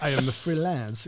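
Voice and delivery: lazy fake Russian accent; imitating a weak Russian accent; bad Russian accent. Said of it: "the Russian accent is not there".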